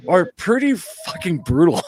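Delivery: slow, with a laugh breaking through; rueful chuckle. Real, not acted. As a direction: laugh as you confess it. Admitting bad convention stories.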